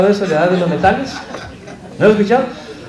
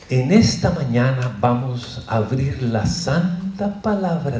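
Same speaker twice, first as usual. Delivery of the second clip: stressing every syllable; 4 semitones lower, 7 dB quieter than usual. Retold or imitated. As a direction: parody a colleague's painstaking diction, syllable by syllable. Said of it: "enfatizando cada sílaba".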